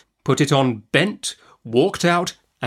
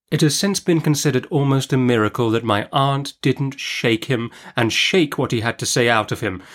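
usual speaking voice

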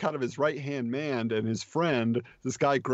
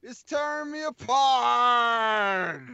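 put-on higher, odd-accented Wiseau voice; Tommy Wiseau impression; 7 semitones higher, slower than usual. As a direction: play the awkward melodramatic leading man. Parody of The Room dialogue.

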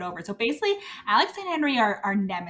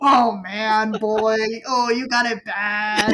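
slow, louder teasing ribbing; mock teasing; 4 dB louder, slower than usual. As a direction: rib him about his crush, drawn out. Teasing Alex about his crush.

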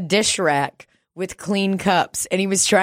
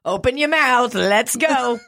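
imitated high rallying holler; raised-pitch mock command; Jerome; 7 semitones higher, 3 dB louder, slower than usual. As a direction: call it out like the spunky coworker. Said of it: "Said it for years after".

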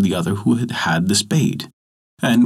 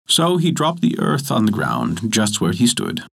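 ordinary delivery

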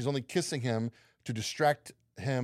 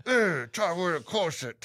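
higher actress impression through a barely opened mouth; Keira Knightley; 5 semitones higher than usual. Cohost corrected on the impression, tight-mouthed mimicry. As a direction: keep the mouth tight and pitch it up. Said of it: "Yeah, there we go".

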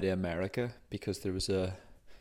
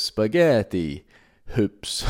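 slow, louder mock Italian punchline; Italian accent; 5 dB louder, much slower than usual. Comedian hitting the punchline to his aunt, in a slow, loud mock Italian accent. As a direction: land spaghetti hoops like a grand Italian chef. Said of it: "putting on an Italian accent for spaghetti hoops".

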